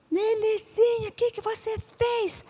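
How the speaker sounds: very high, slow dismayed mother voice; shocked mother. Retold or imitated. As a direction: drag out the horror at the baby's mess. Mother scolds toddler.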